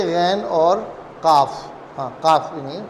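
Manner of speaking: ordinary talk, nothing marked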